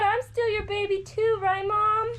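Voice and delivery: put-on high, childlike colt voice; voicing the colt. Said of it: "He said".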